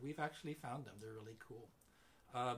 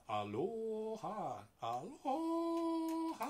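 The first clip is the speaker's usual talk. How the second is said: "aloha" stretched slowly, climbing higher each time; drawn-out rising aloha; 9 semitones higher, 5 dB louder, much slower than usual. Shown as a demonstration. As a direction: sing out "aloha" slowly, going up. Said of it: "So say".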